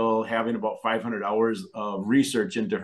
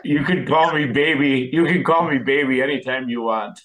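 laughing; 3 semitones higher, 6 dB louder than usual. Real, not acted. Guest laughing, teasing reply.

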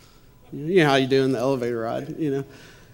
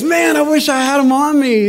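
eager stranger; 11 semitones higher, 8 dB louder than usual. Retold or imitated. Stranger eager to give shoes.

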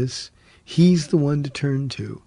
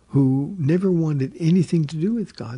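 ordinary talk, nothing marked

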